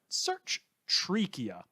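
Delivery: "search trachea" said very slowly, each word set apart; slow spelled-out search term. Shown as a demonstration. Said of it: "That's trachea, but spelt like a tree pun".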